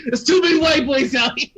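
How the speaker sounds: loud, high quote breaking into laughter; laughing mock complaint. Host quoting favorite channels' founding goal, laughing loudly. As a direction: call it out through laughter.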